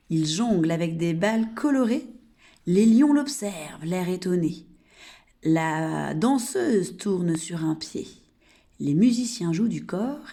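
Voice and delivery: poème dit lentement, bien articulé et fort; réciter la comptine aux enfants en détachant chaque vers; récitation de comptine. Acted. Teacher recites circus poem. (maîtresse récitant une comptine aux enfants, voix posée et forte)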